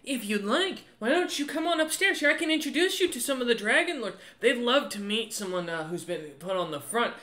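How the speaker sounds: helpful woman character offering introductions=3; friendly woman official. Acted. Game master voices inviting woman.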